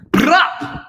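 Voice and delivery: high silly brup; silly brup noise. Pretend. Teacher shows an unnormal noise, a silly brup. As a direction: blurt out a goofy brup. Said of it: "Oh, yeah, there you go".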